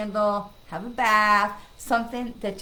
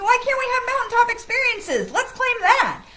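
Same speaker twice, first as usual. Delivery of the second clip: excited; 13 semitones higher, 8 dB louder than usual. Real, not acted. Exuberant call to claim it.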